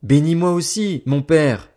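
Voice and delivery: high, loud, bitter plea; bitter cry. Acted. Esau, robbed of his blessing, begs his father: high, loud, bitter cry. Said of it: "il poussa de grands cris pleins d'amertume".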